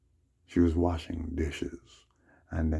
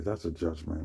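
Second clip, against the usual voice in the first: ordinary delivery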